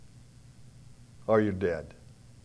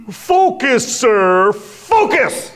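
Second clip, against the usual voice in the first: very high, loud commanding shout; drill-sergeant yell; 10 semitones higher, 9 dB louder, much slower than usual. Retold or imitated. Young Marine yelling to push the flagging marathon runner.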